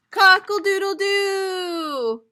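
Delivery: high, slow, loud rooster crow; drawn-out rooster cry. Shown as a demonstration. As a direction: crow it out big. Reading rooster sound aloud.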